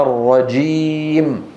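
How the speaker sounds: slow الرجيم with a hard stopped j; plosive jim. Shown as a demonstration. Teacher confirms the right sound, plosive jim. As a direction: hold the closure, then burst into the j of الرجيم. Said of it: "انفجاري".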